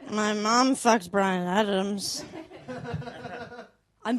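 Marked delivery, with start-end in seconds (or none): 0.1-2.2 slow, low dreamy mumbling confession; mock sleep-talking. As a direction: drag it out low as if asleep. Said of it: "wildly patriotic in my sleep".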